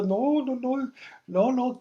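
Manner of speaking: high, hurried string of no's; protest, flustered and caught off guard; flustered denial. Retold or imitated. (negación nerviosa, mucho más aguda)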